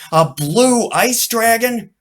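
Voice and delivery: high, slow awestruck question; amazed wonder. A narrator's reading. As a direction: marvel at it. Charlie's amazed thought.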